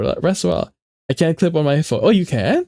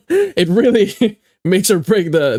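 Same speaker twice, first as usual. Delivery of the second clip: higher-pitched, drawn out through laughs; laughing while talking; 5 semitones higher than usual. Real, not acted. Small streamer on what one viewer means, laughing. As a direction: let giggles break into the words.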